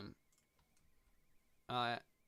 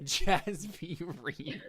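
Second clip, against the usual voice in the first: laughing; 5 semitones higher, 6 dB louder, much slower than usual. Acted. Echoes hype line laughing.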